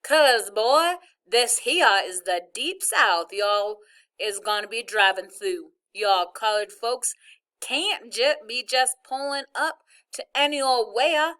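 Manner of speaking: loud, drawling Southern hillbilly twang; Southern hillbilly accent, played for laughs; mock hillbilly accent. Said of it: "Dad did an imitation of a hillbilly accent".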